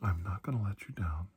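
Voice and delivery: hushed, tender reassurance, partly whispered; reassuring. Acted. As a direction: soothe a frightened patient gently. Comforting the ill patient.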